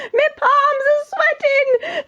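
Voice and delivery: very high, drawn-out wail; scared cook. Acted. Cook afraid of fishing for the king, high panicky wail. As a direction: squeal it like a terrified storybook wimp.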